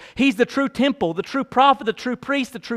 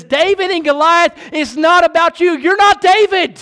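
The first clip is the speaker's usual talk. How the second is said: higher, louder pulpit rebuke; preacher scolding his church loudly; fiery preaching; 7 semitones higher, 5 dB louder than usual. Retold or imitated. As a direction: confront the congregation head on. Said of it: "he looks to them and he says".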